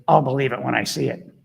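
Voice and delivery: "I'll" stressed, a lightly voiced dismissive doubter; mocking skeptic. Coach imitating a doubting commenter, mildly dismissive. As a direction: toss "I'll believe it when I see it" off as a mild skeptic. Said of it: "people that goes".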